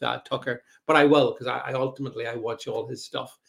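ordinary talk, nothing marked

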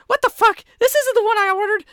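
high, loud, fast indignant yell; outraged Sprocket. Acted. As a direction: shout like a customer sent the wrong order. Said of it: "Sprocket goes".

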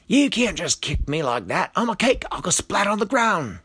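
high, loud indignant yell; loud, high-pitched complaint. A narrator's reading. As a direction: protest loudly to someone below. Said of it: "Robert yelled down".

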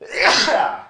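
stifled sneeze; a real sneeze bursting out; sneezing. Real, not acted. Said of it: "I'm about to sneeze".

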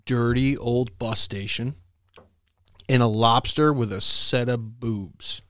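slow, spaced-out riddle delivery; deliberate joke setup. Real, not acted. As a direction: draw out the setup with long pauses. Setting up his dad joke.

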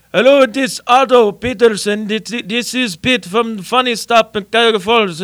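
put-on loud, high thick Lebanese accent. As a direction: mimic a thick Lebanese club owner. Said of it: "with this thick Lebanese accent".

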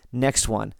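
ordinary delivery

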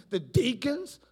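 usual speaking voice

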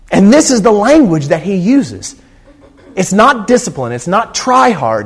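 full-force preaching. Acted. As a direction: preach it hard to the congregation. Preacher pressing the point.

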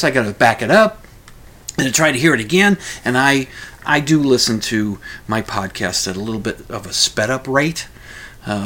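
ordinary talk

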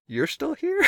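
very high surprised question, imitated. Friends find him still on the line, mock surprise. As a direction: squeak it as the astonished friends.

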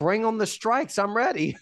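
mock-eager challenge, pitched high; joking bravado. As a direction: playfully throw down a dare in someone else's words. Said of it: "I'm just joking".

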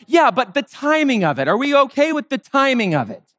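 hammed-up petty quibble; mock quibbling. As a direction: raise the pitch, fussing over a technicality. Mock Pharisees quibbling over a miracle.

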